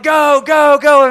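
loud, high go go go; shouted command. Retold or imitated. Swat team shouting the charge into the house, loud. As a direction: bark the raid order. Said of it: "they're like".

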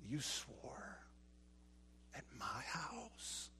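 hushed whispered accusation; mock whispered scolding. Father accusing Marvin, in a hushed whisper.

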